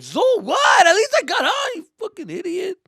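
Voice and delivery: high, loud snapping comeback; defensive yell. Retold or imitated. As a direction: shout back in defense. Retort to cousin.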